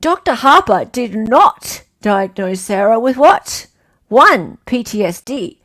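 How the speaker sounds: loud, emphatic quiz-question reading; quiz host. Acted. Host reads a question to online players, quiz host. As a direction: read it out like a quiz host, punching the option numbers.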